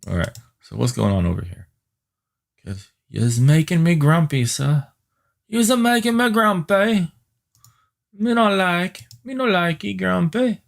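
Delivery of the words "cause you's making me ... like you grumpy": put-on high, babyish grumbling at the computer; silly baby-talk scolding the machine; mock grumpy toddler voice. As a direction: pout it out like a cranky little kid.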